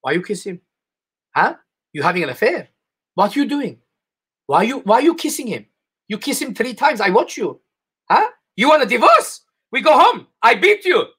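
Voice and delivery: a hint of an Armenian accent, jealous husband; lightly put-on accent, rising into a mock threat. Husband scolding wife for kissing guests, mock accent. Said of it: "your husband will be like".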